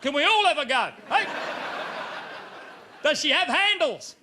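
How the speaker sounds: rough Aussie crowd yelling back; rowdy country punter. Retold or imitated. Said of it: "The audience goes".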